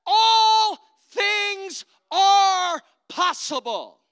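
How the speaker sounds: slow, loud and high proclamation of faith; faith voice shout. Pretend. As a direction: declare it boldly, stretching each word. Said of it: "the faith voice will say".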